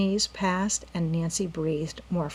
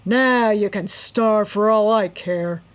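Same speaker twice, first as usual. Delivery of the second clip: slow, louder, cruel gloat; harsh gloating; 3 semitones higher, 7 dB louder, slower than usual. A narrator's reading. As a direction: gloat as you lock her in. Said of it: "the man laughed harshly".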